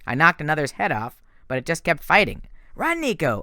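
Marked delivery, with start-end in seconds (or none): 2.7-3.4 yell. A narrator's reading. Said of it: "I yelled".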